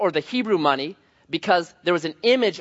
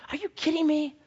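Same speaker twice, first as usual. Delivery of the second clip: much higher, softer outraged disbelief; incredulous; 11 semitones higher, 5 dB quieter than usual. Retold or imitated. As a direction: squeak out your indignation. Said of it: "I'm just like".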